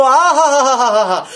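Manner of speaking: drawn-out, exaggerated "aha" of delight; mock rapture. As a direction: gush sarcastically as if enchanted. Mocking awe at English speakers.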